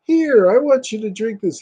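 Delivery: high coaxing voice; high mock coaxing; pushy mother. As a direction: press him to drink.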